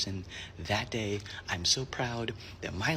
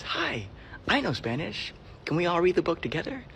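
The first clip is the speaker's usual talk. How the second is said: put-on high, chirpy kid voice; friendly older kid; 7 semitones higher than usual. Kid asking to join two readers, high and friendly. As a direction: ask like a keen child.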